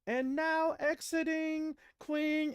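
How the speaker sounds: a small cat herald announcing the queen's exit, high and loud. Acted. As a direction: proclaim it like a tiny pompous herald. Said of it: "one of the smaller cats".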